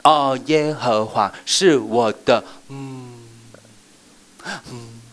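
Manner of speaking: hammed-up halting recital; faked memory slip. Shown as a demonstration. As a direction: pretend to forget the next word. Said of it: "你要背错".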